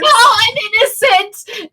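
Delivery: high, loud, hammed-up protest; scream of innocence. Suspect to the park ranger arresting her, high screamed protest. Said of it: "her screams of innocence".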